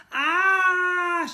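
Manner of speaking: high, loud shocked scream. A narrator's reading. Jemima screaming as her foot sticks in slime. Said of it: "she screamed".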